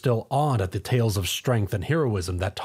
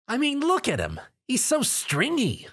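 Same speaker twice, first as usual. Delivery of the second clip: mocking; 12 semitones higher than usual. Rainbow mocks Tom's build.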